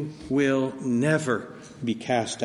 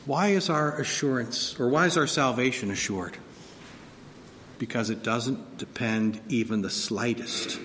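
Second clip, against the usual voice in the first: usual speaking voice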